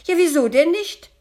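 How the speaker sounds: loud, high indignant retort; offended. A narrator's reading. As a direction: shoot back in high-pitched offence. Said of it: "entgegnete das Gespenst beleidigt". (laut beleidigt)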